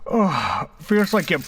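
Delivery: high, strained yelps of pain after a blow; pained cry. Acted. Bruce reeling from the hostess's punch, pained yelps. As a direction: cry out, jaw just smashed.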